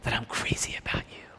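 hushed, intimate whisper into an ear. Acted. Woman's dream of Jesus whispering in her ear, whispered. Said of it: "Jesus leans over to me and whispers in my ear".

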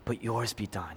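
slow, soft, low yielding; hushed and surrendered, drawn out; quiet surrender. Retold or imitated. Preacher voicing Jesus yielding to the Father, soft and slow. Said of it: "He says".

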